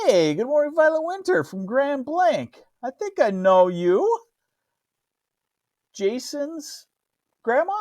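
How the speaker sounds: high, delighted hello to a viewer; pleasantly surprised, pitch way up; excited greeting. Real, not acted. Greeting a viewer he may know.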